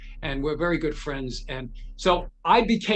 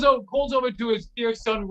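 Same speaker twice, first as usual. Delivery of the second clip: usual speaking voice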